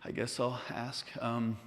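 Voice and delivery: hesitant, soft, halting request; timid, quiet asking; nervous. Acted. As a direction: work up the nerve with long pauses. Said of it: "I'm a little bit nervous".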